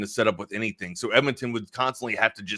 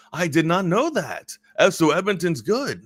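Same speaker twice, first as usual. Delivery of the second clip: lightly imitated French-Canadian accent, higher; 6 semitones higher than usual. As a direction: mimic the surprised buddy's French accent. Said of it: "he's French Canadian".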